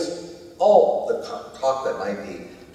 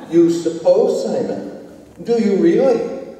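mocking; 7 semitones higher, 5 dB louder, slower than usual. Preacher mocks Simon's answer.

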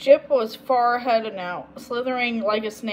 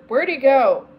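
louder, startled question; alarmed gasp; 3 semitones higher, 7 dB louder than usual. A narrator's reading. Girl losing sight of the dog, mildly gasped. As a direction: ask in sudden alarm. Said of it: "she gasped to Agnes".

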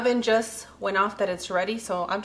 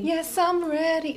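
high demo answer; 8 semitones higher, slower than usual. Shown as a demonstration. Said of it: "Try it again, Cloud".

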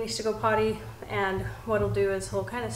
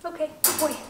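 squeaky praising a dog; 7 semitones higher, faster than usual. Real, not acted. Trainer praising puppy.

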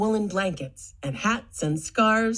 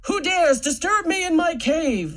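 loud, higher growling dragon; 8 semitones higher, 4 dB louder than usual. Acted. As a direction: roar at the intruder in the cave. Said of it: "the dragon growled".

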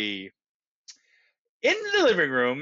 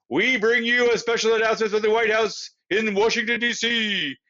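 higher, louder, fast announcer delivery; 5 semitones higher, 3 dB louder, faster than usual. A narrator's reading. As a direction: announce a special bulletin with projection. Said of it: "said the deep voice in, of the announcer".

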